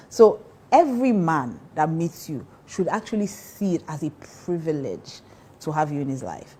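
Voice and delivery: ordinary talk, nothing marked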